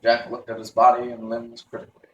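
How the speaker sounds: ordinary delivery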